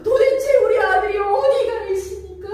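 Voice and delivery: high, loud desperate plea; crying out to God. Retold or imitated. A fasting mother crying out to God, high desperate plea. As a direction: cry out to God for the lost son. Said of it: "날마다 하나님께 외칩니다".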